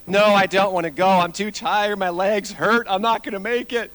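mock whiny kid protest; whining and complaining. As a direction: pile up complaints like a balky child. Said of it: "All the whining and complaining".

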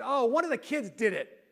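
retold loud, offhand accusation. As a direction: announce it aloud to the room, lightly acted. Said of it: "I said out loud".

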